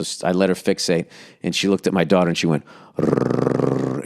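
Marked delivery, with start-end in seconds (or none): 3.0-4.0 low rolling growl; trilled growl of a warning dog. Retold or imitated. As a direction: imitate a dog's long, low rumble. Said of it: "and growled".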